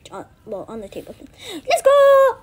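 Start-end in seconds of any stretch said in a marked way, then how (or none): 1.5-2.4 high, very loud whoop; excited cheer. Pretend. Playing at home, excited cheer. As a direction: cheer it out at full volume.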